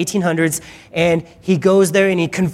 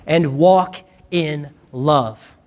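slow scripture reading; 3 semitones lower, much slower than usual. Acted. Scripture read slowly.

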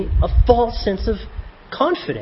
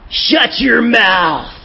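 slow shouted command, higher; harsh law voice; 6 semitones higher, 3 dB louder, much slower than usual. Acted. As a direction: bark it out slowly like a stern old judge.

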